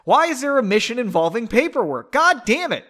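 comically fed-up protest; exasperated rant. Acted. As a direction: build from bafflement to a high outburst.

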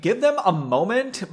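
lightly voiced Valley girlfriend; Valley Girl. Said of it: "like his Valley Girl girlfriend".

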